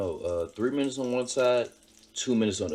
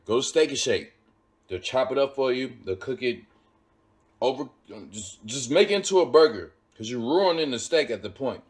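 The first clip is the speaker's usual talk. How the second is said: ordinary talk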